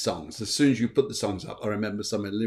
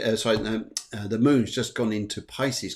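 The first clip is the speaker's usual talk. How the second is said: normal talking voice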